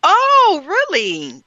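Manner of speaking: a sudden high, loud oh, then an interested really; genuinely taken aback, then curious; surprised oh. Real, not acted. Host reacting to the guest flying to Los Angeles, surprised.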